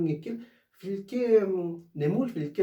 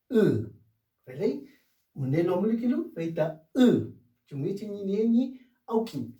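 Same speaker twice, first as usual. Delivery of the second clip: usual speaking voice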